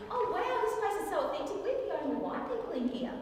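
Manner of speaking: high-pitched gushing amazement; overheard diner. Retold or imitated. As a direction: gush like an awestruck tourist. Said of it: "I hear".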